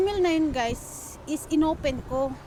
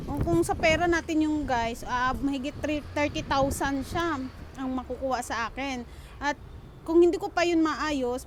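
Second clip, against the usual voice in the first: ordinary talk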